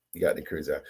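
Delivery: "He got them crazy eyes" dropped low and soft under the breath; quiet aside. Real, not acted. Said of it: "repeated as aside".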